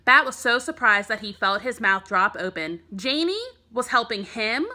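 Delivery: ordinary delivery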